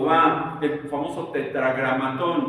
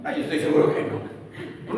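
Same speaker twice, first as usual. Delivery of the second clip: laughing. Real, not acted. Laughs at soft movie version.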